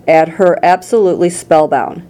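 ordinary delivery

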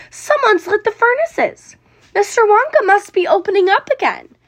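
excited crowd. A narrator's reading. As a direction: announce it as thrilling news. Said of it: "they cried".

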